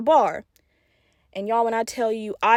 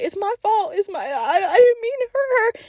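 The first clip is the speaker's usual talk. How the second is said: high, tearful imitation of a frightened boy; scared; 9 semitones higher than usual. Shocked brother explaining to hospital adults, scared and tearful. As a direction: stammer it out, voice rising into crying. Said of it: "he's so scared".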